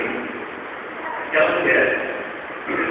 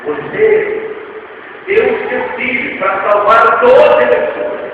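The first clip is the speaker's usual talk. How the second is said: high, loud, building proclamation; full-force preaching; 7 semitones higher, 7 dB louder than usual. Acted. Preacher proclaims to the congregation, full-force preaching. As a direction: preach it with rising fire.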